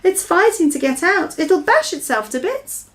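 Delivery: put-on high girl's alarmed voice; alarmed cry. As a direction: Sophie fears for the dream. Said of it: "Sophie cried".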